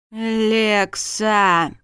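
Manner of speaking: long, drawn-out groan of her name; exasperated groan. A narrator's reading. Husband carrying tipsy wife out, exasperated groan. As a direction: groan it in despair. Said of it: "простонал змей".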